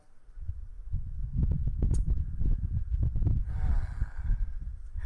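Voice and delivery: a long, loud contented sigh. Real, not acted. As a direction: let out a big satisfied sigh.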